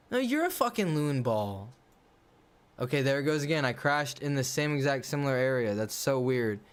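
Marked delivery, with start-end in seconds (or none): none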